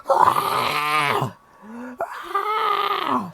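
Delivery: high, drawn-out mock scream; breathy "aah" held long, like a distant scream; imitated scream. Friend retells the screams from the porta potty, mock scream. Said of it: "screaming from the freaking".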